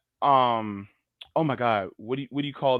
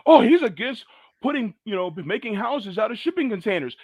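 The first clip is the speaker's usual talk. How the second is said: high, loud imitation of critics; mock outrage; 8 semitones higher, 3 dB louder than usual. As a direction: put on an indignant, much higher voice. Mimicking critics' objection.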